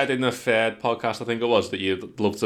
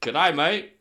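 mock Australian; 7 semitones higher than usual. Shown as a demonstration. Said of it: "that's Australian".

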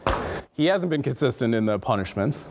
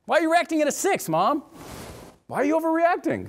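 high, fast mock child's complaint; imitating a kid; 14 semitones higher than usual. Parent voices a child protesting an overreaction, high and mock. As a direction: play the kid protesting to mom.

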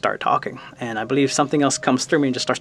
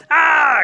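loud, high acted yelp of pain; high pained scream; 13 semitones higher, 6 dB louder than usual. Raccoon with his tail on fire, screaming. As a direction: scream as if your tail is burning. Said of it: "he starts to scream".

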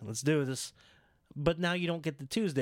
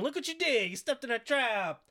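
high gotcha; mock gloating; 10 semitones higher than usual. CM Punk gloating at Paige, high. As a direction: rub it in.

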